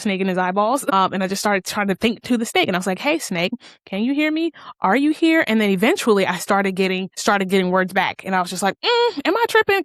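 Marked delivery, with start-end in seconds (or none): 3.0-5.4 rising high to reach the snake; calling to a snake. Retold or imitated. 8.8-9.8 much higher, incredulous "am I tripping"; startled by her own thoughts, squeaky high; self-doubting squeak. Retold or imitated.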